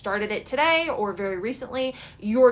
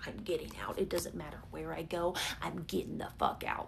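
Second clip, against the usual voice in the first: low determined mutter; 5 semitones lower, 10 dB quieter than usual. Pretend. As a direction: lower and softer, under your breath. Imagined fed-up tenant voice.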